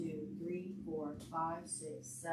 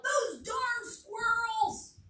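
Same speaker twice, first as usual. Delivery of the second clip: put-on high, slow, stretched-out old man yell; cranky old man shouting at squirrels; grumpy yell; 4 dB louder, much slower than usual. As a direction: shake your fist and holler it. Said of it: "shook his old man fist and yelled".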